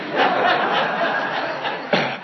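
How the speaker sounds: high, breathless burst of laughter; real audience-sharing laugh; big laugh. Real, not acted. Laughing at own story.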